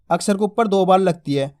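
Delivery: ordinary talk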